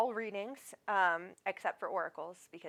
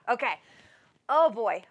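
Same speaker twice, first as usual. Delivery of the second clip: ordinary talk, nothing marked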